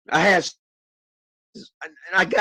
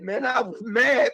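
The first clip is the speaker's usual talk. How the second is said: usual speaking voice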